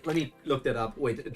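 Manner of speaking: usual speaking voice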